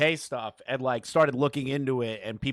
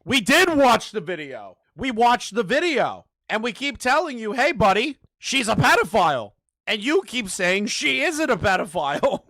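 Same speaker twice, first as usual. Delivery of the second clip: exasperated yelling; 8 semitones higher, 5 dB louder than usual. Real, not acted. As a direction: shout it at him, fed up. Exasperated rebuttal to guest.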